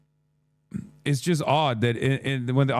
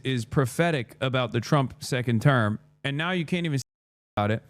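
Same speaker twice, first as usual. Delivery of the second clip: ordinary delivery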